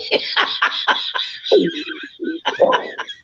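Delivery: high breathy giggle; high laughter. Real, not acted. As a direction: laugh helplessly. Laughing at the joke.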